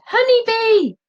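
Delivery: high, loud call; Dad calls for his daughter. Acted. Dad calling in storm.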